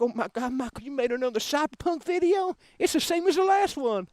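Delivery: a hint of a nagging viewer; mock combative fan. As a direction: imitate an annoyed commenter griping. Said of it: "so combative, like".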